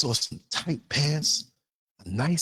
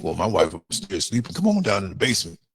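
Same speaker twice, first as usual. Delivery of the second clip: a hint of a low sneaky man, pitched lower; sneaky dude; 8 semitones lower than usual. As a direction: voice a cheating guy luring her in, lower. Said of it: "Some dudes would sneak".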